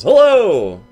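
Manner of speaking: high, loud bright greeting; cheery phone hello. Retold or imitated. Friend answering speakerphone at 2am, loud cheery hello. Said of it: "Bowtie Jones says".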